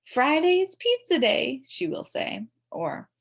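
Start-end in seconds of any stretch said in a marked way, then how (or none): 0.0-1.6 higher, louder, slow PA announcing; loudspeaker announcement. A narrator's reading. Secretary announcing lunch to the school, slow and raised. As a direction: draw it out like a school intercom call. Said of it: "makes announcements over the loudspeaker".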